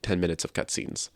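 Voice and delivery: usual speaking voice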